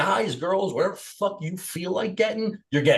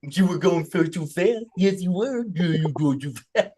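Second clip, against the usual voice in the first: slow, lightly voiced baby talk; mock baby voice. Cop scolds a pulled-over speeder, light mock baby voice. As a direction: scold the speeder like a toddler would. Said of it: "talked to like that all day".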